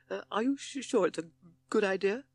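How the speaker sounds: high, stammering toad voice; nervous stammer. A narrator's reading. Toad questioning being used as bait, stammering. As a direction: falter and catch on the words, pitched high. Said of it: "he stammered".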